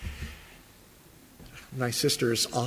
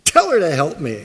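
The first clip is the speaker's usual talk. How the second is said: ordering around; 7 dB louder than usual. Retold or imitated. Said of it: "she gives orders to the Lord".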